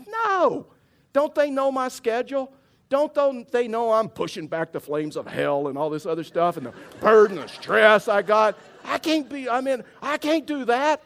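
high, loud indignant rant; comically overwrought refusal; short and rude. Retold or imitated. Stressed husband turning down wife's invitation, rude and worked up. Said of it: "I was short with her".

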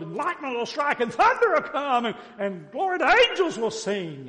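loud, high, grandiose rant building to a shout; proud Naaman. Acted. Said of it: "is that pride or not".

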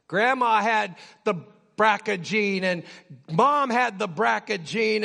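ordinary delivery

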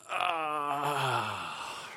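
long falling, sighing groan. Shown as a demonstration. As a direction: let out a drawn-out weary groan. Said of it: "sort of a sigh groan".

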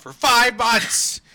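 loud, high outburst; hammed-up exasperated yell; mock-exasperated shout. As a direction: blow up on the words as you read. Exasperated climax of email read.

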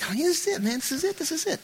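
very high, soft pushy pitch; slick salesman. Retold or imitated. Slick used car salesman hyping a deal, high and soft. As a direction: sell it like a sleazy car dealer. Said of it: "slick used car salesman".

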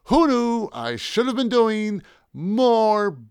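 high, slow howl to the stars. A narrator's reading. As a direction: howl it skyward, stretching the words. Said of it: "howled to Linda's extraterrestrial artists".

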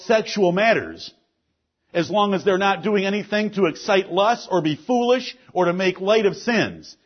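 emphatic, projected sermon delivery; forceful preaching. Real, not acted. Pastor warning the church about sin, forceful preaching. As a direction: preach it firmly, landing key words.

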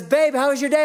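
a hint of the wife asking; light mock wife.

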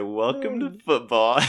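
slow, savoured gloating words; slow payback taunt. Retold or imitated. Leaning down to the sacked quarterback, slow gloating taunt. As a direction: lean in and savour the revenge. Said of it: "And I said".

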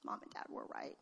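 mock hushed realization; sheepish admission. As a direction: breathe it out as a grudging admission. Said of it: "we're like".

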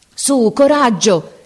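high, drawn-out cry of encouragement; urgent shout of encouragement. A narrator's reading. Captain urging on the distant drummer boy, urgent shout. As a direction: call out high and slow to someone far off.

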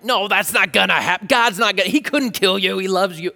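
hammed-up manipulative reassurance; spinning serpent. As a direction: brush the danger away with insistent, tumbling objections. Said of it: "the serpent is kind of spinning it like".

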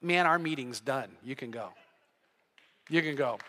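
low, hushed dismissal; quietly firm. Retold or imitated. Dad dismissing the businessman, quietly firm. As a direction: end it softly and with finality.

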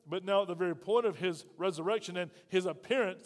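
ordinary delivery

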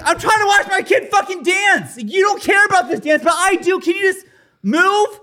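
high, pleading shout; retold shout. Arguing with the man blocking his daughter's dance, shouting. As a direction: yell it at him over the music. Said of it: "And I'm like".

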